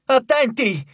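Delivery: urgent warning shout. A narrator's reading. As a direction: yell it before the blow lands.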